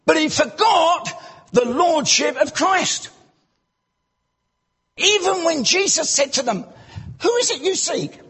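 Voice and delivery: usual speaking voice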